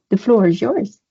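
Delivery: usual speaking voice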